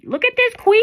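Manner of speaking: high, loud delighted exclamation; excited. Real, not acted. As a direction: show off the card with delight. Excited at card reveal.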